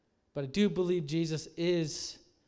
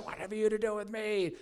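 strained, higher demoniac voice; mock demonic wail, pitched up; weird screaming voice; 4 semitones higher than usual. Shown as a demonstration. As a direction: imitate a possessed man's weird cry. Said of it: "like, in a weird voice".

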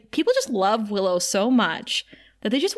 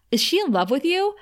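higher-pitched, mildly agitated demand; retold agitation; 7 semitones higher than usual. Oz questioning Tara with hands on her, mildly agitated. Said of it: "like, agitated".

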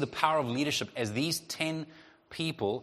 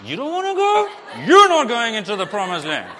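much higher and louder, exasperated snap; harsher human tone; 9 semitones higher, 13 dB louder than usual. Acted. Preacher as himself to the Israelites, harsh exasperated retort. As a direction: bite it off, fed up, half laughing. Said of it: "my tone and my heart might be a little bit harsher".

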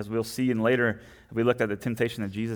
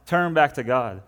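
the same plea, now louder and pressing; prophet's plea; 3 semitones higher, 6 dB louder than usual. Retold or imitated. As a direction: push the repeated call harder, a prophet urging the people.

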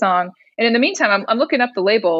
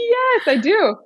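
eager yes; 9 semitones higher, slower than usual. Retold or imitated. Eager answer about loving Jesus.

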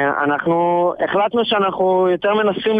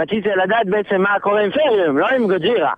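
usual speaking voice

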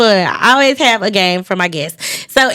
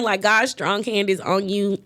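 softer, slow, choked-up reading; reading the verse through real tears; hushed tearful reading of a favorite verse; 9 dB quieter than usual. Real, not acted. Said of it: "I don't know why I'm crying".

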